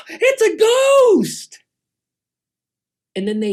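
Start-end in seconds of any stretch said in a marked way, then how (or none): 0.1-1.6 high, slow shout of fright; terrified yell. Acted. Disciples in the boat see a ghost, terrified shout. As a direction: scream it out in panic, much higher and louder. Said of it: "And they start to yell".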